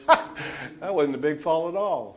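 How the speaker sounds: God's amused, laughing reply; slow, chuckled dismissal through laughter; imitated laughing. God answering the worried young pastor, imitated laughing. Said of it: "I heard God laugh".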